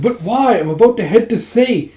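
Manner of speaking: high-pitched whiny objection; mock put-out complaint; protesting. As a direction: object in acted dismay. Acted protest at being stopped.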